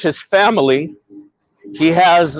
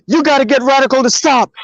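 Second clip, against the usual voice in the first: retold panic, higher and fast; hysterical caller; 7 semitones higher, faster than usual. Friend phones demanding help, mock-hysterical. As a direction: imitate a frantic caller, pitched up. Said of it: "This motherfucker is hysterical".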